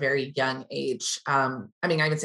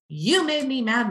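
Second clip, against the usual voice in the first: put-on child's complaint; 8 semitones higher, slower than usual. Quoting five-year-old son.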